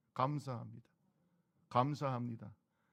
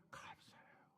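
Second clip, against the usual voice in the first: whispered thanks; 21 dB quieter, slower than usual. Acted.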